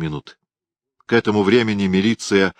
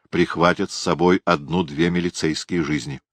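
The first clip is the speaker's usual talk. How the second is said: ordinary delivery, nothing marked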